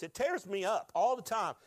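pushing through real tears in the throat; preacher overcome, pleading with the congregation; emotional. Real, not acted. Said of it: "The reason why I'm emotional".

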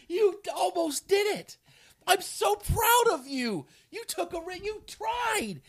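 high, loud, slow cheering; proud parent. Retold or imitated. Parent cheering a kid who just fell off a bike. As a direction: cheer on a child learning to bike. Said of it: "you're like".

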